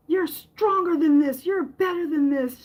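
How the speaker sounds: a mother's firm pep talk to her grieving daughter; firm mom encouragement. Retold or imitated. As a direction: push her up with tough love. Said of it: "she said".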